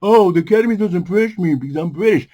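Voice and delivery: hammed-up sulky imitation; mocking Christopher Nolan. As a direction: parody a director moaning about the Academy. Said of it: "so he can stop complaining".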